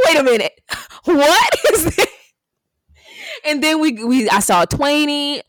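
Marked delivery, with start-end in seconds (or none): 0.1-2.1 higher, laughing disbelief; retold surprise. Friend recalling her shock at the surprise video, laughing. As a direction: act stunned at the video. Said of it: "I'm like".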